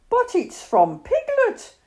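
high, slow cry of alarm. A narrator's reading. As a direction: exclaim it in startled dismay. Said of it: "cried Christopher Robin when he had read it".

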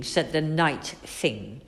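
ordinary delivery, nothing marked

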